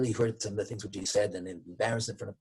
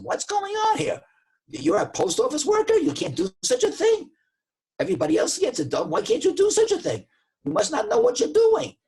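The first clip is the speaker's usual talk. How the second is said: much higher and louder, a furious customer shouting; angry yelling; 6 dB louder than usual. Retold or imitated. Customer yelling at the post office clerk, furious. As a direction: tear into the clerk with outrage. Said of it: "And he starts yelling at him".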